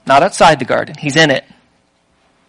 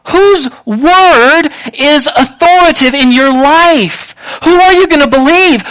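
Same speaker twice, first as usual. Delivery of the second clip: normal talking voice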